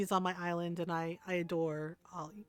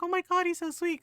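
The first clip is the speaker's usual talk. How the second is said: high-pitched, touched gushing; 10 semitones higher, 4 dB louder than usual. Retold or imitated. Friend tells her about kind Ron, gushing. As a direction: gush like a charmed friend, pitched way up.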